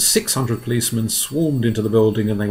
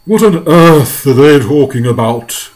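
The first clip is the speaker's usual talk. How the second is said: loud, slow, higher creature voice; grasshopper's voice; 4 semitones higher, 11 dB louder than usual. Acted. Old grasshopper puzzled, asking the centipede, slow character voice. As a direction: ask it slowly and loudly in character.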